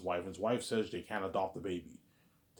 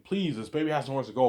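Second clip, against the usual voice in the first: raised, louder acted plea; begging; 5 semitones higher, 6 dB louder than usual. Man to the adoption clerk, pleading for the baby. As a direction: press the plea once more. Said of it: "he's begging her".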